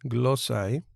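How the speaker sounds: spell-it-out Greek pronunciation; Greek word demo. Shown as a demonstration. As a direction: sound out glossai for the class. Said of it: "podemos identificar el diptongo ai".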